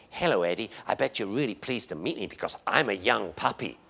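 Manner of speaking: softly voiced eager young puppy; puppy's voice. Young puppy introducing itself to Eddie, softly voiced puppy. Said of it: "The puppy says".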